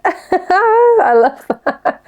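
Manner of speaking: laughing hard, high and slow; cracking up mid-sentence; real laugh through the words. Real, not acted. Laughing at the moan.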